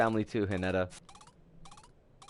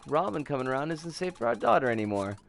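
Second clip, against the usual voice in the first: ordinary talk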